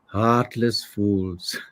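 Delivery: slow, mildly sorrowful delivery; sad character line. Acted. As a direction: draw it out with a touch of sadness. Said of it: "So he's very sad".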